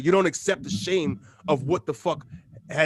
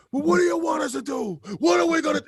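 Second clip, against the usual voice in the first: much higher mock shouted plea; helpless protester; 12 semitones higher than usual. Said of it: "He goes".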